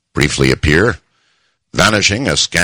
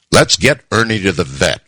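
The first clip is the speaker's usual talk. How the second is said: ordinary delivery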